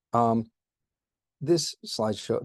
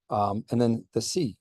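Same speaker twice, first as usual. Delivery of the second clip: usual speaking voice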